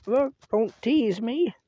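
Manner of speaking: pleading cat voice. Pretend. Man voicing his cat, begging not to be teased. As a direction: beg like a hungry cat. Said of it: "he says".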